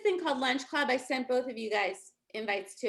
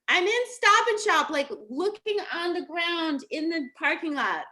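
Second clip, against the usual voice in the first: animated retelling; 5 semitones higher, 5 dB louder than usual. Retold or imitated. Comic retelling of frantic search.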